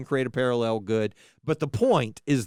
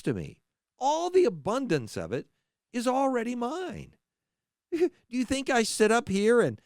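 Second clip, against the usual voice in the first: impassioned, rising divine voice, pleading and stern; God rebuking his people; 6 semitones higher than usual. Acted. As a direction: speak as God, swelling with passion at his own.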